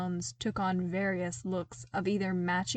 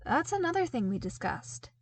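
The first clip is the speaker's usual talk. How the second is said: bright; 7 semitones higher than usual. A narrator's reading. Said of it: "Luke informed them brightly".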